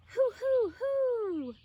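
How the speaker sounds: high, loud hooting owl. A narrator's reading. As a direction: hoot like an owl. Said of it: "An owl says".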